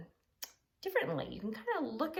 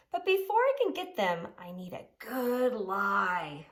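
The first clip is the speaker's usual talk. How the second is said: louder, slow sneaky plotting voice; scheming pug; 6 dB louder than usual. Acted. Pig schemes aloud in a slow, sneaky voice. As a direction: plot the lie with relish.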